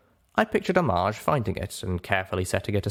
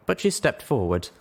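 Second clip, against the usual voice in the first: usual speaking voice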